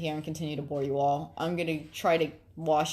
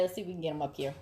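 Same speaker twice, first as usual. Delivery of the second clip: usual speaking voice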